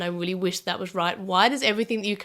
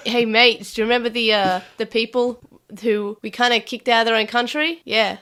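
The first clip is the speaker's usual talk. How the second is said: hammed-up matey Aussie; put-on Australian accent; 4 dB louder than usual. Australian proposing a takeover to his mates, put-on Australian accent. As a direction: talk the mates round in broad Australian. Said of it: "putting on Australian accent".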